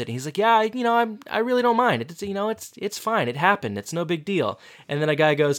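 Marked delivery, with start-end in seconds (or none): 0.4-4.6 mock nonchalance. Dumped guy feigning indifference.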